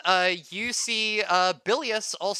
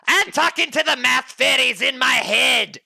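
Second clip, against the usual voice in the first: put-on higher, louder drunken shout; drunk barkeep shout; 6 semitones higher, 7 dB louder than usual. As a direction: yell it like a tipsy barman.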